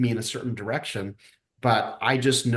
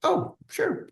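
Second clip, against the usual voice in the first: jumps way up high in startled compliance; a squeaky, flustered "oh, sure"; caught off guard. Retold or imitated. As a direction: sound thrown by the curveball. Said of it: "I'm just like".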